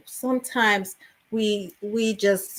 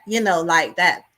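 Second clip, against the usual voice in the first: normal talking voice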